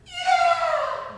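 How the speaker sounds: high, loud yelling whoop; joyful jump yell; distant whoop. Retold or imitated. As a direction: yell like someone leaping out. Someone jumps yelling.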